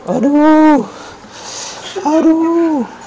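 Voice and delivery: high yelps of real pain; pained cries. Real, not acted. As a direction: cry out over and over in pain. Patient cries out during massage.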